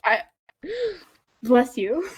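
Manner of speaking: ordinary talk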